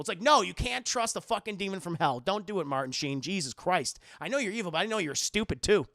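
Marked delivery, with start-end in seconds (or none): none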